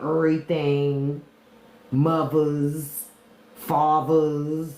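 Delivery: DC twang on everyday words; drawn-out Washington DC pronunciation; DC accent. Shown as a demonstration. Local showing chat how DC people say things, DC twang. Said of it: "little twang of how they say things".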